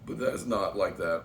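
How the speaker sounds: normal talking voice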